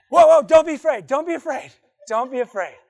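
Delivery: loud, high scramble, easing slightly; frantic reassurance. Acted. Gabriel to startled Mary, frantic reassurance. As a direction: hurry to calm someone who is panicking.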